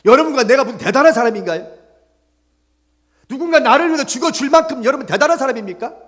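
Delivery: high, loud, driving sermon delivery; pressing the point home to the congregation; full-force preaching. Real, not acted. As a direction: preach it out with rising urgency.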